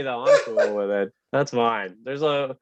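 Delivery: ordinary delivery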